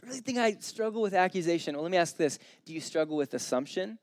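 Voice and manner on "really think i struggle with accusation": mock self-assured. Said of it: "if you're like".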